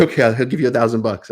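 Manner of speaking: quick, breezy offer in a put-on adjuster voice; mock insurance adjuster. Lawyer voicing the insurer tossing out a cash offer, quick mock adjuster voice. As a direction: rattle it off like a brisk insurer.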